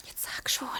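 soft whispered urging; whispering. Acted. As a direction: whisper it, nervous and pressing. Nervous girl urges in the dark.

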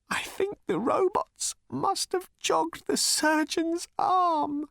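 high, slow, tearful voice; crying. Acted. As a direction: weep through the words. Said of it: "He started to cry".

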